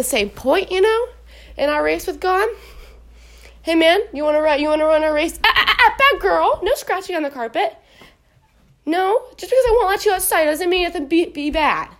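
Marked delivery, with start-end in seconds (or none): 5.4-11.9 scolding the dog. Real, not acted. Owner catching the dog scratching the carpet, real scolding. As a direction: cut in to correct a misbehaving pet.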